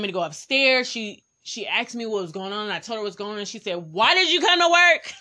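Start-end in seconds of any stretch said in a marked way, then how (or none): 3.8-5.1 high, loud mock disbelief; incredulous manager. Manager to employee in pain at work, incredulous. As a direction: imitate a manager's loud, high-pitched scolding. Manager scolds her, retold.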